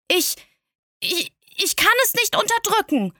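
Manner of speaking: put-on a small boy caught out, groping for words; flustered little prince. A narrator's reading. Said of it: "antwortete der kleine Prinz ganz verwirrt".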